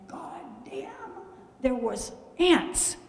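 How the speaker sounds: slow whispered curse of disbelief; stunned whisper. Retold or imitated. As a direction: whisper the curse slowly, as if just discovering disaster. Retells shock at finding ants.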